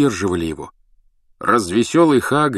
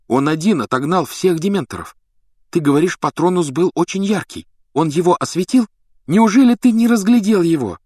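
high, excited questioning that builds louder; insistent; 9 semitones higher, 4 dB louder than usual. A narrator's reading. Hermione pushing Harry to recall the wizard, insistent. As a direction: press harder, rising to an incredulous exclamation. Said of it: "настаивала Гермиона".

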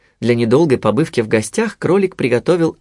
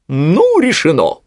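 loud, high owl pronouncement; proclaiming; 5 semitones higher, 4 dB louder, slower than usual. A narrator's reading. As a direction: declare it as settled. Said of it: "провозгласила сова". (провозглашая, голосом совы)

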